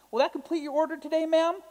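high, polite clerk asking about the order; drive-thru clerk. Retold or imitated. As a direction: sing it up like a fast-food clerk. Drive-thru worker voiced in story.

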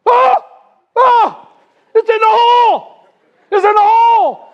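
high, loud shouts of mock jubilation; excited whooping. Golfer shouting to friends on finding a hole in one, wildly excited. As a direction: imitate a golfer going crazy with joy. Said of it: "he's going crazy. He's so excited".

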